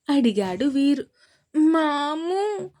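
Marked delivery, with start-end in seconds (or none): 1.6-2.7 drawn-out, higher whimpered మాము; mild weepy child calling his uncle; crying little boy. A narrator's reading. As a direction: stretch మాము slowly like a tearful toddler. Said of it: "అన్నాడు విహాన్ ఏడుస్తూ".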